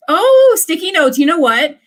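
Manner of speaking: high, loud delighted gasp of an idea; excited. Real, not acted. Remembering a favourite gift for viewers, an excited outburst. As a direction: burst out with a sudden idea. Sudden idea of sticky notes.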